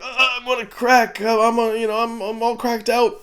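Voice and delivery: high, strung-out mock addict voice; cracked-out addict. Reviewer mimicking Falcon high on drugs, cracked-out impression. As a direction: play a hammed-up jittery man on drugs. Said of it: "he's definitely like".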